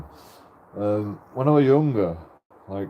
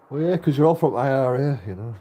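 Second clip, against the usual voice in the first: ordinary talk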